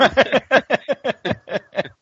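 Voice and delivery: high-pitched mock giggle; high giggling laugh. Rick gloating after the kill, a high mock giggle. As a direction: giggle like a gleeful villain.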